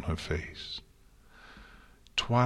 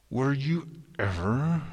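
high and tight through clenched teeth; 6 semitones higher than usual. A narrator's reading. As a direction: grit your teeth and push it out. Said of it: "through clenched teeth".

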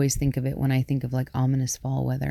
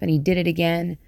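ordinary delivery

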